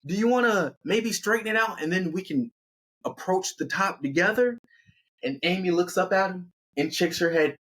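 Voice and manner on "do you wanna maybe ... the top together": high-pitched call down a cliff, easing off toward the end; acted holler to someone below; yelling down. A narrator's reading. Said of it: "And he yells down".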